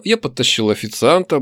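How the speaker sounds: ordinary delivery, nothing marked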